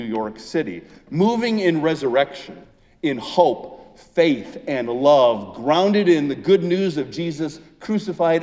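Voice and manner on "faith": ordinary talk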